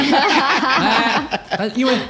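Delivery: a high laughing "hey"; cracking up at the punchline; amused burst. Real, not acted. Laughing at own joke.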